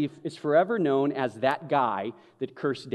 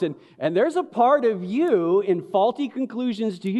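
normal talking voice

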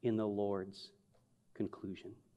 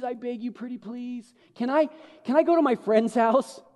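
pleading puppy eyes; 6 semitones higher than usual. Retold or imitated. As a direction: kid begging dad, high and whiny. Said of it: "Like, really nice".

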